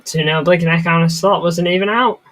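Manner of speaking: loud, excited goal reaction. Real, not acted.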